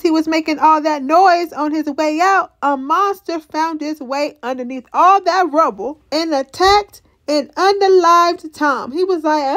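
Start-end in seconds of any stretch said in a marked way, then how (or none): none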